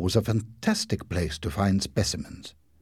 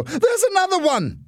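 very high, loud scream of warning; panicked, piercing cry; 5 dB louder than usual. A narrator's reading. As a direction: shriek a frantic warning. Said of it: "screamed Margot".

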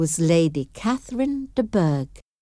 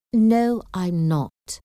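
usual speaking voice

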